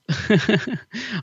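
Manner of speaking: caught-out laugh. Real, not acted. Presenter laughing at not having the news ready, real giggle. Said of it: "I'm laughing 'cause I haven't got it ready".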